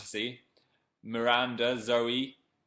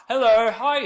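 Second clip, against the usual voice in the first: very loud, very high shout of hello; shouting hello to the viewers on the stream; excited hello; 12 semitones higher, 13 dB louder than usual. Real, not acted. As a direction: squeal a welcome.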